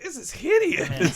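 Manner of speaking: high, slow, hammed-up disgust; mock disgusted Wonder Woman. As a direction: play her appalled, dragging it out. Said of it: "she's like".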